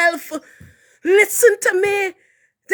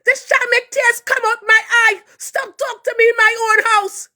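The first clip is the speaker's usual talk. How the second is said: ordinary delivery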